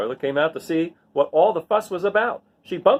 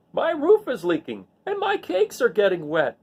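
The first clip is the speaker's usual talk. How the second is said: moaning; 7 semitones higher than usual. A narrator's reading. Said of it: "he moaned".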